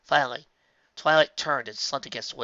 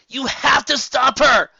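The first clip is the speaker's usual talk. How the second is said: slow loud high cry; 13 semitones higher, 13 dB louder, slower than usual. A narrator's reading. Rainbow cries out to the others, loud. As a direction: cry out in alarm. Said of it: "Rainbow cried".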